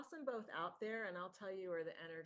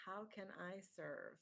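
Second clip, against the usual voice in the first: ordinary talk, nothing marked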